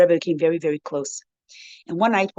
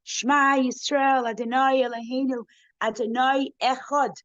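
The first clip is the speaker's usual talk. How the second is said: retold scream; 6 semitones higher than usual. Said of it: "They screamed together".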